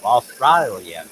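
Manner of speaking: Australian drawn out slowly in broad Aussie; Australian accent. Shown as a demonstration. Woodworker repeats the accent lesson, slow Australian accent. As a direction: stretch Australian with a wide Aussie vowel. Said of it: "an Australian person would say".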